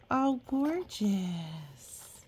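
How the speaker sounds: usual speaking voice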